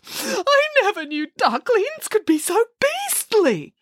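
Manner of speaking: high, slow tearful whimper; weeping Boris. A narrator's reading. Boris weeps at the cruel ducklings, high and slow. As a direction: cry the words out in dismay. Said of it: "wept Boris".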